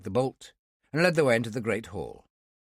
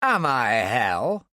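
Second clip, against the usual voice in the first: slow, loud, scornful outburst; scoffing retort; 4 dB louder, much slower than usual. A narrator's reading. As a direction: throw the question back with scorn. Talbot scoffs at the question.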